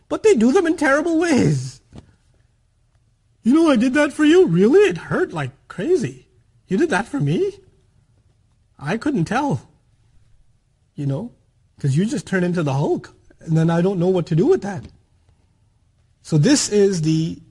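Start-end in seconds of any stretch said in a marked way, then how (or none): 3.4-14.8 sarcastic hurt reply. Retold or imitated. Hurt partner answering a resentful spouse, sarcastic and loud. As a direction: needle them with bitter sarcasm.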